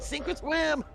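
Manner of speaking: high-pitched mock dad's holler; shouting dad. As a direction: toss it out high, playing a dad. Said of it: "your dad tossing you in the deep end and said".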